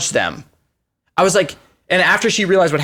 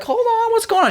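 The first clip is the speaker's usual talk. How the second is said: confused driver. Retold or imitated.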